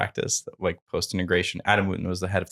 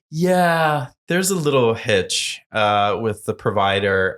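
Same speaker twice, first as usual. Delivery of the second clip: stalling admission; slower than usual. Retold or imitated. Founder admitting a glitch to organizer, imitated stalling. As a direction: break bad news slowly, stretching the words.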